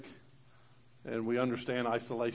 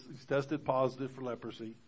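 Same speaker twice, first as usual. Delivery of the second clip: ordinary talk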